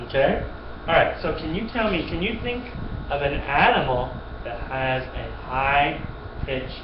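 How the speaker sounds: ordinary delivery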